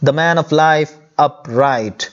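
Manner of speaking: "the man of life upright" said whole and slowly, with no breaks between the syllables; line read smoothly. Shown as a demonstration. Teacher rereads the counted line whole for students, slow and smooth. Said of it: "तो ये six सिलेबल्ड line है".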